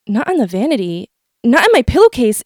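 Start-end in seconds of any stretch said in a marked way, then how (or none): none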